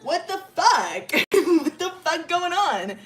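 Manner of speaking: loud, high, laughing through the words; cackling, baffled bro; laughing disbelief. Retold or imitated. Milo baffled after Ellie runs.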